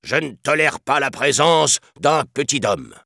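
high, indignant commander voice; imperious declaration. A narrator's reading. Elephant chief rejecting the man-cub, imperious. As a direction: pronounce it as a stern ruling. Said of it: "déclara Hati".

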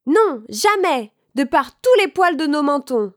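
defiant little pigs. A narrator's reading. Trois cochons refusent au loup. (petits cochons qui refusent, voix aiguë et forte)

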